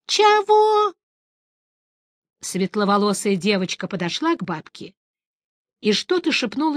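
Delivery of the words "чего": put-on old woman voice. Old woman's startled reply.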